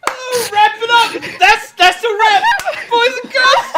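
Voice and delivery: loud, high shout through laughter; shouting wrap while cracking up; laughing shout. Real, not acted. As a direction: yell it like a director calling wrap.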